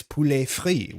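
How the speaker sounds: poulet frit said slowly with silent T's, poo-LAY FREE; French soft T. Shown as a demonstration. Streamer teaching fried chicken in French, slow with silent T's. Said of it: "two T's that end, and they're both soft".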